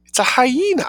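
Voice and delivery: high excited cry; sudden high exclamation; cried out. A narrator's reading. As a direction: call it out in shock. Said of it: "I cried".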